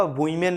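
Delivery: वुमेन as WUI-men; wrong pronunciation. Shown as a demonstration. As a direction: glide from "woo" to "ee" in वुमेन. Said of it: "completely wrong".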